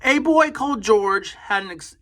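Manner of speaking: usual speaking voice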